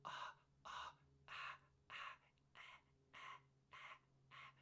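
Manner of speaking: breathy whispered ahs sliding; quiet unvoiced larynx exercise. Shown as a demonstration. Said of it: "is you go".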